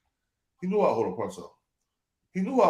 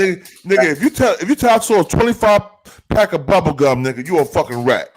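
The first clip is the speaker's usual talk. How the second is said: louder, hammering home the point, voice raised; loud emphatic rant; 8 dB louder than usual. Real, not acted. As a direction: shout down any excuse for snitching. Heated point about snitching.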